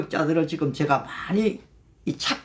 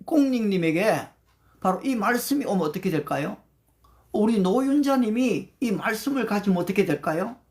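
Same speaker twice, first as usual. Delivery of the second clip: normal talking voice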